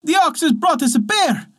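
high excited shout. A narrator's reading. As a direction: yell for him to come kill it. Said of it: "she cried".

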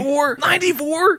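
can't believe the number; incredulous. Real, not acted. Host hears guest scored ninety-four, incredulous squeak.

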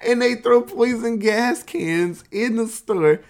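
high, slow, tickled telling; laughing while talking. Real, not acted. Teller recapping absurd gas attack, laughing voice. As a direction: push the words out through real laughter.

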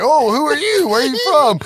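high, loud mock alien voice. Retelling the movie scene, a high loud alien. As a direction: squeak it out like a curious creature.

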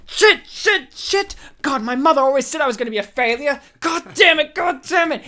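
high, fast, frantic cursing under pressure; panicked self-cursing. Retold or imitated. Bill panics, cursing himself.

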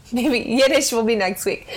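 usual speaking voice

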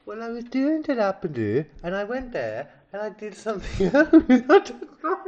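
lilting, exaggerated Welsh accent attempt. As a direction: attempt a sing-song Welsh accent, breaking into laughter.

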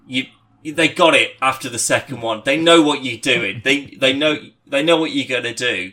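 exasperated telling-off. Retold or imitated. Mark scolding his co-star on stage, mock exasperated. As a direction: tell him off for milking it. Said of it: "he was just like".

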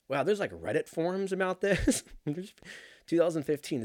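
usual speaking voice

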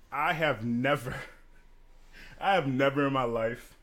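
normal talking voice

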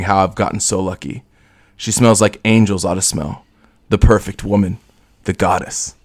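low, brooding hardboiled narrator; noir voice. Acted. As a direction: read it like a weary film noir detective. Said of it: "in my noir voice".

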